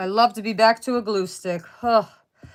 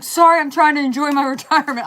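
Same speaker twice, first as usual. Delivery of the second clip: mimicking husband; 7 semitones higher, 6 dB louder than usual. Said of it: "he's like".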